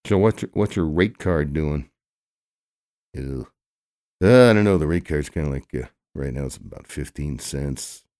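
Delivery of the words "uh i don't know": loud uh. Acted. Loud reaction.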